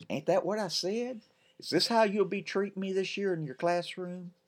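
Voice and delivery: put-on high, cheeky boy voice; playful boy's tease; teasing kid. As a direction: needle her like a little brother. Ben teasing.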